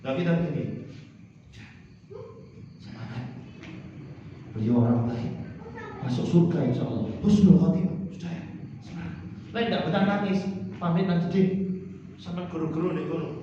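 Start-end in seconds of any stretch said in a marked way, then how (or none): 2.8-3.2 soft encouragement. Acted. Consoling mourners quietly. 8.1-9.1 soft encouragement. Acted. Consoling mourners quietly.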